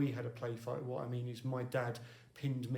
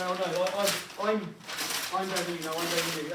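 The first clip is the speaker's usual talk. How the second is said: loud, high-pitched mock bragging; mock boasting squabble; 5 semitones higher, 9 dB louder, faster than usual. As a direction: act out a petty one-upping quarrel. Acting out people squabbling over status.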